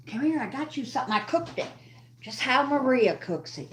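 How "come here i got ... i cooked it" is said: high, fast sing-song coaxing to a pet; high cooing pet voice. Real, not acted. Coaxing her little dog to eat.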